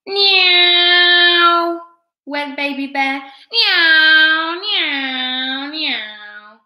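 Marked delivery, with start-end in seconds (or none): 0.0-1.8 loud, high, long plane whine; aeroplane noise. A narrator's reading. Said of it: "pretending to be an aeroplane". 3.5-6.6 plane whines falling from high and loud; swooping toy-plane drone, fading; aeroplane noise. A narrator's reading. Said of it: "pretending to be an aeroplane".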